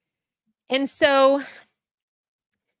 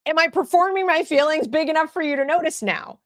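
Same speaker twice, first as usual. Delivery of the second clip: put-on higher, pleading kid voice; pitched-up imitation of a kid begging for attention; mock plea for notice; 8 semitones higher than usual. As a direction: ask it like a teen desperate to be noticed. Voicing a troubled kid's plea.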